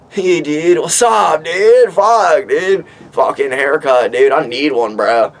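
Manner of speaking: high, laid-back stoner dude voice; surfer dude character. Pretend. Looking at his own haircut, doing a high surfer-dude voice. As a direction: play a chill bro checking his hair. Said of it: "be that character".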